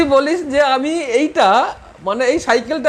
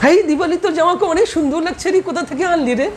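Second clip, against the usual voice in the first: higher-pitched mock gushing compliment; admiring girl friend; 4 semitones higher than usual. Teacher acting Tanushree spotting Dipali's new dress, high admiring voice. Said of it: "Tanushree জামাটাকে দেখেছে".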